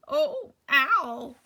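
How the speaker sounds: loud, glad exclamation; overflowing relief; happy reunion. Acted. Eddie reunited with Al, loud glad cry.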